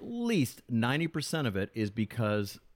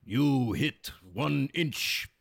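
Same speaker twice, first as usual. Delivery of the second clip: put-on slow, broken-English accent, word by word; halting mock broken-English delivery; Mister Miyagi; 2 semitones higher, much slower than usual. Reviewer mimicking the movie sensei's broken English, halting accent. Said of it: "do that broken accent".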